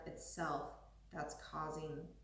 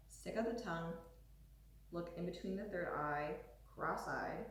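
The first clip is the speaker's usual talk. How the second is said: ordinary talk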